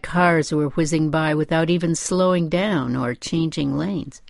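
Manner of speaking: ordinary delivery, nothing marked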